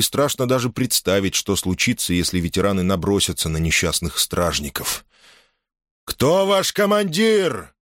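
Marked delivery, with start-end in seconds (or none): 6.0-7.7 high, slow, thundering command; booming shout. Acted. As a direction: call out across the field. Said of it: "прогремело над полем".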